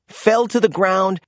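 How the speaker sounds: ordinary delivery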